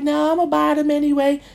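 high voice for the woman insisting; mock woman. The woman insisting she'll buy his sneakers, high-voiced. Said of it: "She was like".

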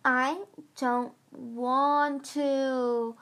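very slow, high whine; complaining. Acted. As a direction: drag out a sulky whimper. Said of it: "Squid complained again".

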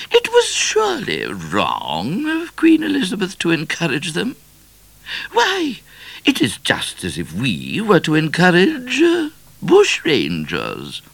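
high, insistent regal voice; disapproving queen. A narrator's reading.

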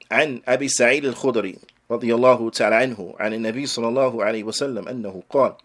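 rapid Arabic recitation of a narration chain; hadith recitation. Acted. Teacher reciting the hadith chain to students, rapid recitation. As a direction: rattle off the isnad quickly in classical Arabic.

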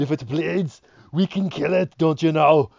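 put-on loud, high Arnold voice in a Canadian accent, adding eh; Schwarzenegger with a Canadian accent. Joking about actor voices, mock Arnold with Canadian accent. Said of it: "Arnold Schwarzenegger's voice with, like, a Canadian accent".

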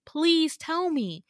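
retold plea with a long, high "please"; exasperated plea. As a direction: act out begging for an answer, slowly. Begging him for answers in quarrel.

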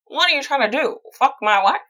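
loud, high mock yell, hammed up; shouty comic impression of a jealous husband; yelled angry quote. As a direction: play an outraged husband, loud and over the top. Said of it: "He yells at Walken".

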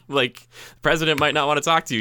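normal talking voice

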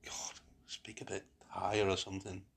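a private whispered self-rebuke; whispered thought. Retold or imitated. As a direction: whisper it to yourself. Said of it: "when I listen to myself, I just think".

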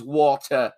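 "water" with crisp T and no final R; British pronunciation. Retold or imitated. Co-host objecting that San Diegans don't say it, "water" as "waw-tuh". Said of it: "He says water".

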